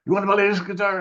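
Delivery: high-pitched mimicked seller; challenging shopkeeper. Guitar seller sizing up the kid, challenging imitation. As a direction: put on the pestered vendor, voice jumping up.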